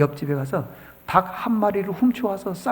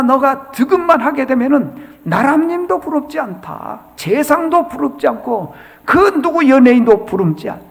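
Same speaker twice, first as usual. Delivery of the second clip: louder, higher retold scolding; 5 semitones higher, 8 dB louder than usual. Master scolds his daughter to reach deugeum, loud scolding. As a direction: press the lesson on her like a strict master. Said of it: "송아에게 야단을 치는 거예요".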